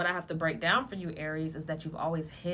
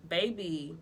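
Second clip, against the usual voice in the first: usual speaking voice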